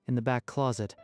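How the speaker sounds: usual speaking voice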